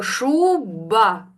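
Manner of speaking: "Шуба" slowly, with a stretched ШУ; long ШУ, then a separate falling БА in "Шуба"; sounding it out. Shown as a demonstration. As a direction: draw out "Шуба" so each sound is heard. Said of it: "промов його".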